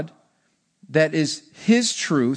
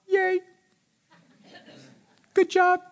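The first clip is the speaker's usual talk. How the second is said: mock half-hearted cheer; 13 semitones higher than usual. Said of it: "do you just stand like this and go".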